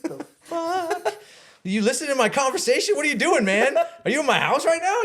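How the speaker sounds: high, loud, laughing disbelief; stunned, half-laughing yell; shocked disbelief. Retold or imitated. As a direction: freak out that he overheard you. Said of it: "And I'm like".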